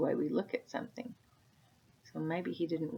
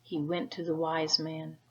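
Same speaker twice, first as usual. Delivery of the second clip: usual speaking voice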